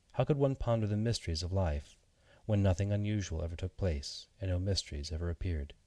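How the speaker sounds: ordinary delivery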